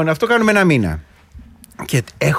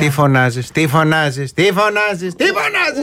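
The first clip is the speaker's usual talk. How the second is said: mock scolding mother. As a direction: imitate a mum's rising telling-off. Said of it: "Δεν το λέγανε αυτοί οι μάνες μας".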